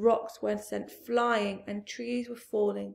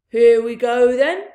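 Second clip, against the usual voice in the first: shouted; 2 semitones higher, 14 dB louder than usual. Acted. Bean on his tractor shouting to start the attack, loud shout. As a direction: roar it out over the engines. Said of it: "shouted Bean".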